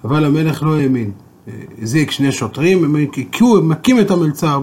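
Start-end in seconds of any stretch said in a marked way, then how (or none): none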